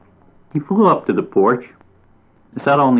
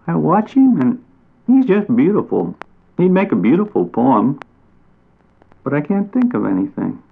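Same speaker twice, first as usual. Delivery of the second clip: higher, longing little bat voice; admiring bat; 6 semitones higher than usual. A narrator's reading. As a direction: marvel at the cardinal, then sag.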